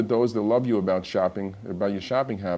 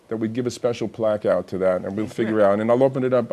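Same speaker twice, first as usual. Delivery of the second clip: normal talking voice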